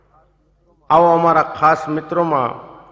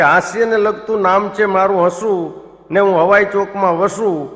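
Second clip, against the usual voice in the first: proud couplet recital; 3 semitones higher, 3 dB louder than usual. Retold or imitated. Friend repeating Hasu's shayari, louder mock-proud recital. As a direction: recite it again louder, savouring each line.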